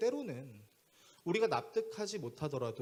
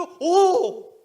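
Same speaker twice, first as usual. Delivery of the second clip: one high, very loud yell; angry retort; 16 dB louder than usual. Retold or imitated. Pastor answering young man's protest, angry. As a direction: cut off the whining with a shout. Pastor yells back in retold quarrel.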